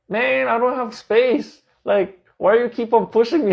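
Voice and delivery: a hammed-up gripe from a cramped seat; mock annoyed complaint. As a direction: push back at the crowd, fed up. Squeezed into the full jeepney.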